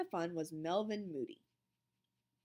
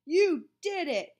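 loud, slow, higher angry shout; boy yelling at Melvin; 8 semitones higher, 10 dB louder than usual. A narrator's reading. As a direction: accuse him hard. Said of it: "he shouted".

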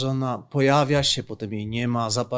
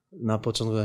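ordinary talk, nothing marked

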